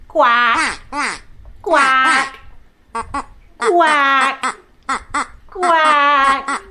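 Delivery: drawn-out, playful quacks; duck quack. Pretend. Teacher leads children flapping wings, playful duck quacking. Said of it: "let's quack and flap our wings like a duck".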